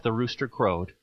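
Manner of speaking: ordinary delivery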